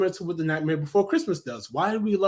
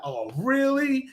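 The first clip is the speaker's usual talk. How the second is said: mock disbelief; 6 semitones higher, slower than usual. Imagined viewer reacting to the reveal.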